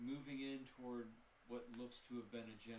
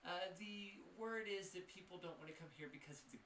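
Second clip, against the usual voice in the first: evasive shopkeeper; 10 semitones higher, 4 dB louder than usual. Acted. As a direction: dodge the question, then hint at ghosts.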